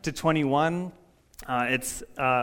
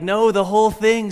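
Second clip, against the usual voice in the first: insistent reply; 4 semitones higher, 6 dB louder than usual. Retold or imitated. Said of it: "And God's like".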